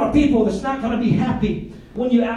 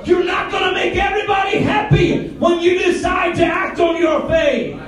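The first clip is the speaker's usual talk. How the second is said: loud, high, shouting sermon delivery; fiery shouted sermon, higher pitch; full-force preaching; 8 semitones higher, 4 dB louder than usual. Acted. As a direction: preach it hard to the congregation.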